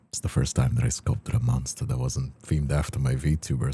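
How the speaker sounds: ordinary talk, nothing marked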